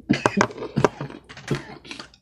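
harsh cough after a hit; smoker's cough. Real, not acted.

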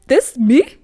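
loud, proud disbelief; mock amazed. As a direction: show off the new room, half-joking. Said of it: "I was like".

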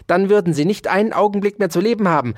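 rapid, high, spiteful threat; venomous threat. A narrator's reading. Dauerspeck threatens the mouse's life, venomously. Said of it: "sagte Ambrosius Dauerspeck giftig".

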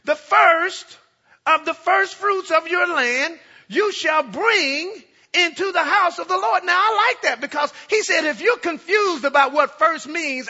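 high, loud, slow preaching; preaching at full force. Acted. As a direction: hammer home the word bring.

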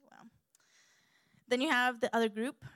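ordinary talk

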